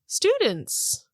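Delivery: high incredulous "Students?"; surprised. Acted. Harpist surprised at a student request. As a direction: echo it back in disbelief.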